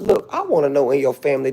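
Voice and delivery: ordinary talk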